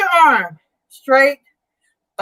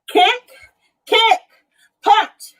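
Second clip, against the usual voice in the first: higher, punchy cues; energetic move calls; 8 semitones higher, slower than usual. Real, not acted. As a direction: call each move with drive. Calling moves.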